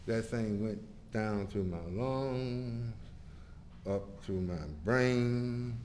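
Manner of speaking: soft, slow, drawn-out wonder; low, spellbound storytelling; hushed reverie. Retold or imitated. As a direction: trace it slowly and softly, savouring each step. Relives the drug taking hold.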